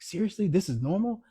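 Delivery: high, incredulous double-take; retold disbelief. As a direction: gasp it out like you can't believe the crowd. Retold shock at the crowd.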